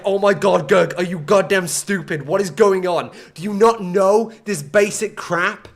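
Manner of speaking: loud, exasperated mock rant; imitated irate viewer. Streamer acting out chat scolding him, loud mock outrage. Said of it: "Are people going like".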